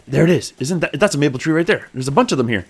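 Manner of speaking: usual speaking voice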